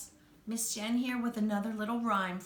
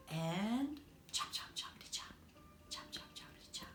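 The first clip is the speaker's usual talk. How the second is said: fading into a whisper; 10 dB quieter than usual. Shown as a demonstration. Storyteller hushes the chopping chant for the children. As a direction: chant sinking to a soft whisper.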